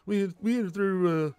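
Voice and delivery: lightly voiced school counselor, lifted a bit with repeated okay; a light take on the South Park counselor; mild Mister Mackey voice; Mister Mackey impression. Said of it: "Crumb's, uh, Mister Mackey".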